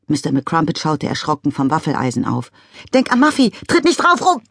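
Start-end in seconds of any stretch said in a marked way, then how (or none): 2.9-4.4 high, loud alarmed cry; alarmed warning. A narrator's reading. Father startled, warning his daughter.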